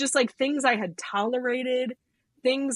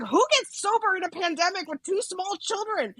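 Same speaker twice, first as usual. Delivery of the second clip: high-pitched, incredulous imitation of a scolding, laugh breaking in; mock-mad friend; 10 semitones higher than usual. Sober improv friend berating her over pandemic sobriety, hammed-up outrage. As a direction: mimic an outraged friend in a much higher voice. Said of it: "She was so much mad at me".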